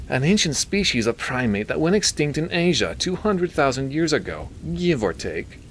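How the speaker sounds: high nasally would-be British expert; Milton. A narrator's reading. Doctor naming the creature for the priest, nasally. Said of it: "He had a nasally voice".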